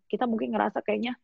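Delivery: normal talking voice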